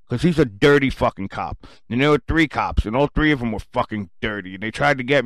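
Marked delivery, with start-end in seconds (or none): none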